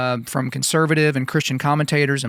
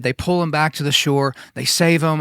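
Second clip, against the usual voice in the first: ordinary talk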